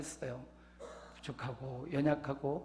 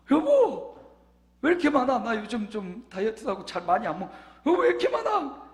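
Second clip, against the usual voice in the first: high, loud mock complaint to his wife; complaining; 8 semitones higher, 7 dB louder than usual. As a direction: whine about the huge bowl of noodles. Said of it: "불평하듯 말을 했잖아요". (아내에게 투덜대듯 높고 크게 불평)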